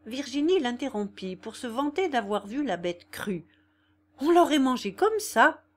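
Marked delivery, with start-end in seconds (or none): 4.2-5.6 eager boast. A narrator's reading. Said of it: "disait-elle".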